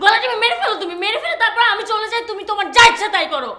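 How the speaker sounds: high-pitched, desperate outburst at a partner; angry yelling. Acted. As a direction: fling it out in exasperation, voice pitched high. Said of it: "চিৎকার করবে না তুমি এখানে".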